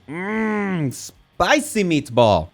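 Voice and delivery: slow, relishing "spicy meatball"; savoring. Real, not acted. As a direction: drag it out like tasting a great dish. Savoring a bass like food.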